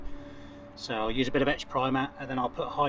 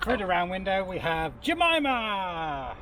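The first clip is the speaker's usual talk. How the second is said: higher, slow, sing-song presenter voice; kids TV presenter; 6 semitones higher, slower than usual. Pretend. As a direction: announce it like a children's show host. Echoing the Play School catchphrase.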